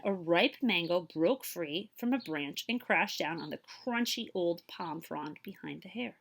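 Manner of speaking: plain reading voice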